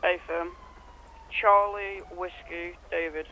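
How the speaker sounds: usual speaking voice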